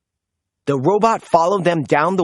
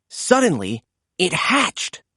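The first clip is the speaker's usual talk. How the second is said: ordinary delivery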